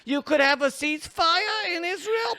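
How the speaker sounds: a meek, sorrowful rabbi asking very politely; sad rabbi voice. Pretend. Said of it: "that's a sad rabbi voice".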